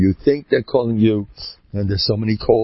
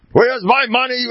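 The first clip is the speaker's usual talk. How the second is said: angry callers; 12 semitones higher, 4 dB louder than usual. Retold or imitated. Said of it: "All these guys calling you up".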